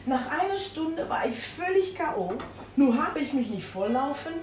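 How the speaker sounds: ordinary talk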